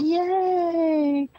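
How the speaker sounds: loud, high, drawn-out yay; happy, ringing cheer; real cheer. Real, not acted. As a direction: celebrate with a long whoop. Cheering news that everyone can hear.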